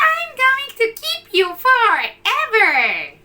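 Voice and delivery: put-on high, sweet girl's voice; girl adopting puppy as her doll; little girl. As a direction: claim the puppy happily.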